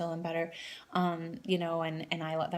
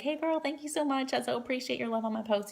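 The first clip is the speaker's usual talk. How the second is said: much higher, gushing thank-you; bubbly girlfriend greeting; 7 semitones higher than usual. Shown as a demonstration. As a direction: squeal it like you're greeting a close friend. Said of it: "'cause I'd be like".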